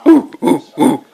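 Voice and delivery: short bursts of pretend monkey ooh-oohs; monkey noises. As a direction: hoot like a playful monkey. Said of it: "Is that a monkey".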